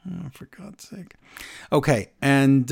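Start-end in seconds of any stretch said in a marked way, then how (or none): 0.1-1.1 slow, hushed exasperation; very soft, drawn-out grumble; exasperated mutter. Real, not acted. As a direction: sigh it out under your breath. Exasperated at co-host's promise.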